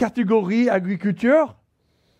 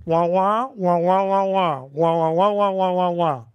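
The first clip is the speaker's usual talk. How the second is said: Charlie Brown adult wah wah; muffled trombone-like wah wah; muffled wah wah talk; 3 semitones lower than usual. Retold or imitated. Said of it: "il fait". (voix d'adulte wah wah étouffée)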